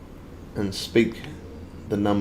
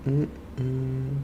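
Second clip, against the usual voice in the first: ordinary talk, nothing marked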